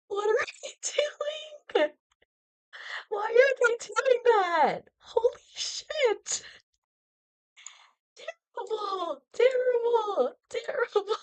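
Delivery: high, drawn-out dismay; distraught meltdown. Real, not acted. Live meltdown over the call-up.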